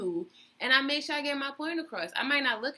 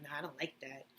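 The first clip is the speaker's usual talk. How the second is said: mock low voice; 7 semitones lower, 11 dB quieter, faster than usual. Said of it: "He was like".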